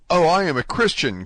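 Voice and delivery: loud, high, desperate cry; cried out. A narrator's reading. Prisoner cries out to the hidden voice, loud and high. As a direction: cry it out to the unseen stranger. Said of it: "cried Dante".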